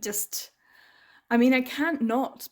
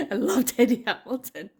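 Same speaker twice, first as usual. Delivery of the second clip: laughing; 3 semitones higher, 4 dB louder than usual. Real, not acted. Repeats it with a laugh.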